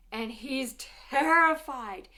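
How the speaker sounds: loud, drawn-out stress on "terrified"; slow, booming storyteller's alarm; dramatised terror. A narrator's reading. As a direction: stretch "terrified" out big for the kids. Dramatic telling of the king's fear.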